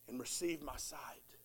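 hushed whisper of wonder; whispered awe. Retold or imitated. Healed blind man recounting his healing, whispered. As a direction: breathe it out in awe.

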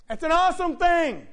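high, loud preacher's exclamation; ringing, emphatic repeat; exclaimed praise. Real, not acted. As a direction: shout it so they feel it. Repeats it louder to congregation.

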